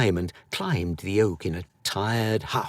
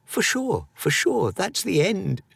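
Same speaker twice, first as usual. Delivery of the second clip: high, panicky squeak; frightened boy, sure he's doomed; a boy's high frightened thinking; panicked thought; 10 semitones higher than usual. Acted. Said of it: "he thought".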